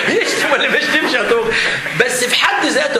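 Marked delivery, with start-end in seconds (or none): 0.0-1.5 asking again through real laughter; laughing while teasing the student. Real, not acted. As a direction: let the laugh break into the question.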